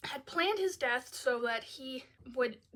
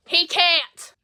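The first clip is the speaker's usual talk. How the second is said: loud, high protest; shouting at the film's mistake; outraged shout; 6 semitones higher, 17 dB louder than usual. Real, not acted. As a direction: burst out at the plot hole. Outburst at the plot.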